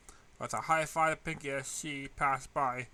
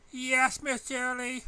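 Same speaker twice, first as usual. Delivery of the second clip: high, louder, sing-song innocence; innocent; 10 semitones higher, 6 dB louder, slower than usual. A narrator's reading. Student greeting teacher with innocent grin, high and bright. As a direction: play the innocent angel.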